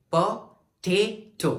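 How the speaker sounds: "potato" very slow, gaps between po, TAY and to; syllable by syllable. Shown as a demonstration. Teacher showing the longer sound, "potato" slowly separated. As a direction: stretch the middle syllable of "potato". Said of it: "टे में हमारी energy भी बढ़ रही है".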